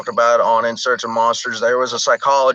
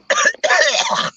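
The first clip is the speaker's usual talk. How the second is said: choking cough on a swallowed bug; sudden coughing fit. Real, not acted. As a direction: hack and sputter after swallowing a bug. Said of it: "Sorry, I swallowed a bug".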